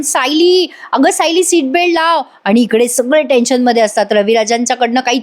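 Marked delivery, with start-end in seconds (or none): 0.0-2.2 higher-pitched mock yell, no real volume; retold shout. Arjun calling to Sayli from outside, retold shout. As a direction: imitate someone calling out urgently. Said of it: "Arjun ओरडत असतो सायलीला बाहेरून".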